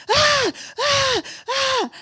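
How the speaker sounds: high, wailing baby cries, mimicked; imitated baby wail. Imitating colicky baby's crying.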